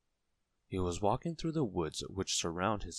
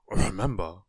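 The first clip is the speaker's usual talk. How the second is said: louder put-on tramp voice; kindly tramp; 8 dB louder than usual. A narrator's reading. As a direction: give a parting reminder as a friendly vagrant. Tramp's parting advice.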